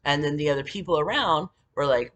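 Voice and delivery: ordinary talk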